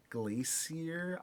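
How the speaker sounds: ordinary talk, nothing marked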